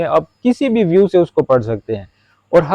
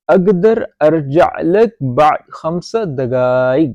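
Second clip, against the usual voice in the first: slow model sentence; much slower than usual. Shown as a demonstration. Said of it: "model sentence read slowly".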